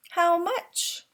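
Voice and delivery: lightly voiced young son; small son asking. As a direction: pipe up eagerly like a little boy. Child asks papa in story.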